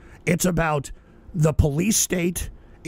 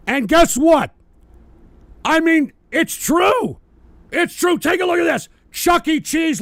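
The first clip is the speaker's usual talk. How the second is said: raised, much higher ranting voice; ranting loudly; 10 semitones higher, 6 dB louder than usual. Real, not acted. Streamer ranting at his viewers, loud and high. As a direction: work yourself up and hammer it at them.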